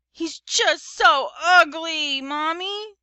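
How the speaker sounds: put-on high, loud, drawn-out child whine; small boy protesting; whiny little brother. A narrator's reading. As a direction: stretch it out, complaining to Mommy.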